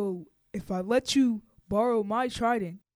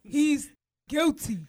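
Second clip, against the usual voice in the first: loud slow verdict; 5 semitones higher, 5 dB louder, slower than usual. Acted. King declares verdict.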